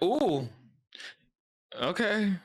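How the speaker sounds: a high, startled ooh then a hesitant okay; awkward surprise. Retold or imitated. Imagining the Cullens sitting down, awkwardly surprised. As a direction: act caught off guard by strange new lunch mates. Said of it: "I'd be like".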